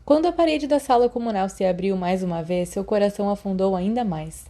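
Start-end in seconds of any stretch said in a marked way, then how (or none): none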